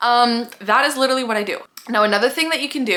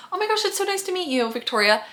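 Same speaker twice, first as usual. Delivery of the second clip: sweet reply; 8 semitones higher than usual. Acted. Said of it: "person B replies".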